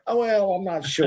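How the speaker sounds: high, whiny unsure mumble; a green distiller hedging, half-hearted whine; whining. Retold or imitated. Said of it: "I was kinda whining".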